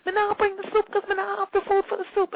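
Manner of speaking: high, loud patois imitation of the delivery man; Jamaican patois. Delivery man explaining the missing soup, loud patois imitation. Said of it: "he's yelling something".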